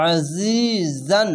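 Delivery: আজিজান slow, the জ buzzing; buzzing jha. Shown as a demonstration. Teacher showing the buzzing জ sound in আজিজান. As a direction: let the জ of আজিজান buzz. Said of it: "দেখেন".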